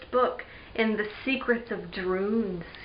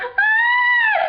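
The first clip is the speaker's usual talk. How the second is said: very high, loud sustained scream; piercing scream of alarm; 8 dB louder than usual. Acted. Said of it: "Julie screamed".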